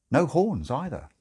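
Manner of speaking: plain reading voice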